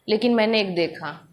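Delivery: ordinary delivery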